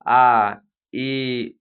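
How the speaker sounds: ई stretched long and loud; long vowel demo. Shown as a demonstration. Teacher demonstrating long ई to pupils. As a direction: hold ई twice as long. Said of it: "दोगुना समय लग रहा है बड़ी ई".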